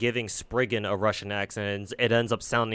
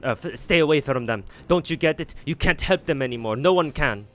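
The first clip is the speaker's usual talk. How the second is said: loud, pleading warning to stay back; urgent warning; 5 semitones higher, 6 dB louder than usual. Acted. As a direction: warn them off, raised and urgent.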